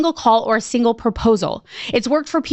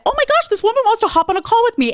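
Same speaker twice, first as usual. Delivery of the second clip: high, fast and gushing; giddy excitement; 10 semitones higher, faster than usual. Retold or imitated. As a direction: blurt it out thrilled and breathless. Excitedly telling Jenny the news.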